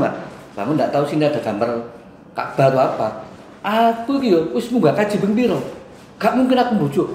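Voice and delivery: normal talking voice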